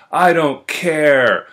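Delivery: loud, slow, high mock whine; hammed-up petulant bellow; stubborn drunk protest. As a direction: drag it out like a defiant drunk. Drunk friend's stubborn refusal, retold.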